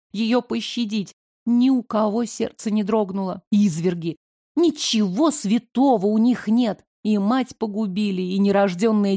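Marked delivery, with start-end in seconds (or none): none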